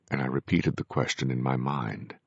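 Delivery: ordinary delivery, nothing marked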